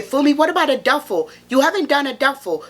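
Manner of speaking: high-pitched imitation of a pleading viewer; imagined viewer asking. Playing a viewer asking Fumi about a duffel, high mock plea. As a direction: pipe up as the nagging audience. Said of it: "If you're thinking".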